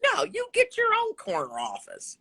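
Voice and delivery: quoted boss, pitched well up, dismissive. Retold or imitated. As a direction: mimic the office boss brushing her off.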